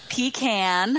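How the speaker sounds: pecan as slow PEE-can; pronunciation demo. Shown as a demonstration. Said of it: "come up with the four ways".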